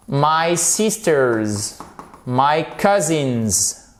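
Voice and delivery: slow "sisters" and "cousins", each ending in Z; clearly sounded S. Shown as a demonstration. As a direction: stretch "sisters" and "cousins" to show the final Z. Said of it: "prononcez bien ce S".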